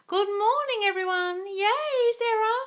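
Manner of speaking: high, squealing hello; excited greeting. Real, not acted. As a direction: cheer as a friend arrives. Greeting viewer joining live.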